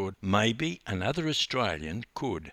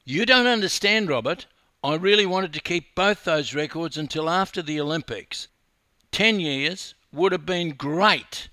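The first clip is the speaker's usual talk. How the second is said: loud, higher-pitched protest; exasperated; 9 semitones higher, 7 dB louder than usual. A narrator's reading. Wife explaining her lost records to husband, exasperated. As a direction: protest with raised pitch and volume.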